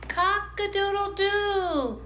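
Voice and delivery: high, slow rooster call; stretched cock-a-doodle-doo; rooster crow. A narrator's reading. Rooster crows.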